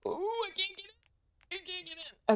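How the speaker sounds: high, strained panic at getting stuck; real alarm at being trapped; panicked. Real, not acted. Stuck, panicking while climbing.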